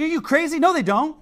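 hammed-up disbelief; mock indignant outburst. Retold argument, man denying.

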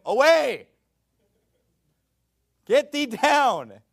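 booming high divine command, slow on the last words; commanding God voice. Retold or imitated. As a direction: thunder it down as God dismissing Moses. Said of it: "And the Lord said to him".

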